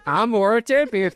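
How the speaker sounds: laughing through the words. Real, not acted. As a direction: giggle through a proud boast. Laughing at own title.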